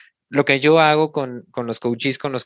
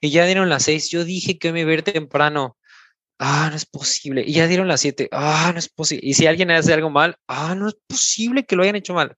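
exasperated grumbling, rising higher as it goes; mock complaining; 4 semitones higher than usual. Coach imitating herself griping about work, exasperated. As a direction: sigh out each complaint like a fed-up worker. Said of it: "ocurre algo y de inmediato".

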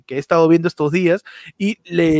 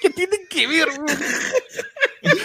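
much higher, laughing outburst; hammed-up high-pitched outburst; mock exclamation; 10 semitones higher, 4 dB quieter, much slower than usual. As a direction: squeal it out breaking into laughter. Sudden mock Mexican exclamation.

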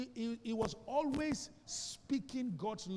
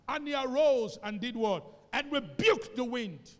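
loud, raised preaching call; 4 semitones higher, 9 dB louder than usual. Acted. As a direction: proclaim the verse with full force. Preaching verse at full force.

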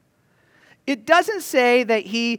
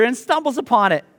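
normal talking voice

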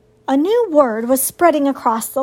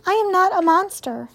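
lightly voiced robot, pitched higher; higher, measured robot heroine; 7 semitones higher than usual. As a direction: answer evenly as a robot. Robot heroine answers the animals.

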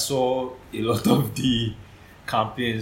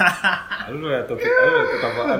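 laughing; 7 semitones higher, 4 dB louder, faster than usual. Real, not acted. Dodging the question, laughing.

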